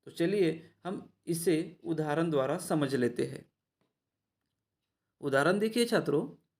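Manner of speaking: normal talking voice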